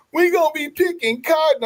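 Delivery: high, put-on whiny lament; hammed-up imitation of a tearful TikTok woman; mock wailing woman.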